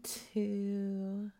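hiccups. Real, not acted. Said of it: "Don't laugh at my hiccups".

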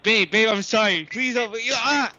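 high-pitched, put-on panic; mock pleading. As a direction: beg in hammed-up fright. Caller pretends to be getting hit.

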